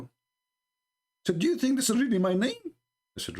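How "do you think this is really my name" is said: put-on high, exasperated girl's voice; annoyed girl. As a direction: play her huffing at the mix-up, pitched up.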